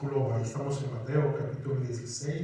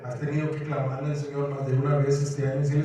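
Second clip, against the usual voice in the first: ordinary delivery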